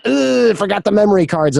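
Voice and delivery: high, whiny ugh of a careless assistant; whiny excuse. Retold or imitated. Lazy assistant groaning to the interviewer, high and whiny. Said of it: "someone's like".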